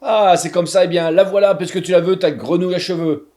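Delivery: loud, furious giving in; witch howling. A narrator's reading. Witch forced to hand over the frog, loud howl. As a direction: yell it out as she gives up. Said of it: "la sorcière se met à hurler".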